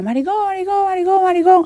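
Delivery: high-pitched mock yell, held back in volume; imitated distant shouting. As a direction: imitate kids jeering from far off. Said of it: "se ponían a gritar de lejos".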